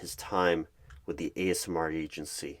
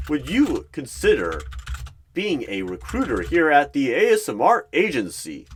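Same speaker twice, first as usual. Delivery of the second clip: big, loud, higher game show host; boastful game show voice; 6 semitones higher, 8 dB louder than usual. Acted. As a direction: boom it out like a cheesy quiz host. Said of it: "big, boastful voice like he is on some weird game show".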